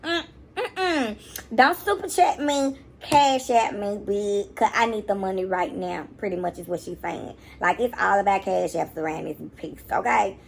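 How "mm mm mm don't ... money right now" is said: dismissive mock mother; slightly hammed dismissive; rude mimic. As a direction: imitate a rude brush-off. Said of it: "so rude".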